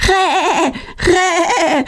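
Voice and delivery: high-pitched jay squawk; bird screech. A narrator's reading. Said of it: "mit lautem Geschrei".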